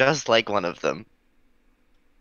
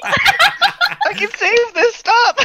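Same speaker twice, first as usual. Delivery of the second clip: high, loud laugh-shouting; 9 dB louder, slower than usual. Real, not acted. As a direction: shout through helpless laughter. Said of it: "player laughing while shouting".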